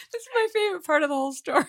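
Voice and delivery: high laughing shout; real laughter through the words. Real, not acted. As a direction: cackle it out, delighted. Host laughing at story.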